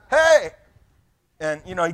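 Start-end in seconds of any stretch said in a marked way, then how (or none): 0.1-0.5 sudden full-throated "Hey!", much higher and louder; yelling at a lion. Retold or imitated. Pastor yelling at a lion for a better photo, loud shout. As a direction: bark it out to wake a sleeping lion. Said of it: "So I yelled at him".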